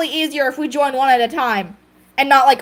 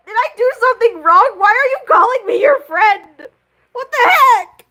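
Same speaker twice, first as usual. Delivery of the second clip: high, hammed-up sob-voice plea; mock crying; 7 semitones higher than usual. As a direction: play the wounded mate crying, half laughing. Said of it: "He starts crying. He's like".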